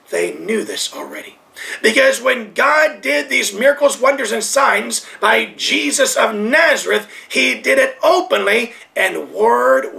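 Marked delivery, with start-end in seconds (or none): none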